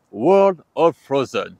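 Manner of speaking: slow, loud attempt at British English; showy British accent. Shown as a demonstration. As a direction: show off a mock British accent. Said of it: "Vous avez vu mon accent?".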